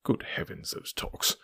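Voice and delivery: breathy, near-voiceless rueful murmur; a weary private aside under the breath. Acted. Holmes groaning about the housemaid talks, voiceless rueful aside.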